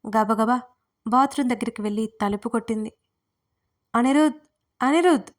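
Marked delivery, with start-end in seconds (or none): 3.9-5.3 calling loudly, higher each time; shouting his name. A narrator's reading. Swara pounding on the bathroom door, shouting. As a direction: yell it through the bathroom door. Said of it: "గట్టిగా అరిచి".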